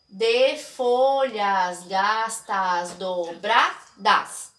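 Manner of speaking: slow, every syllable of "de folhas gastas dobradas" separated; counting grammatical syllables. Shown as a demonstration. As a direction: tap out all eight grammatical syllables slowly. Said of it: "eu tenho oito sílabas gramaticais". (contando as oito sílabas gramaticais, devagar)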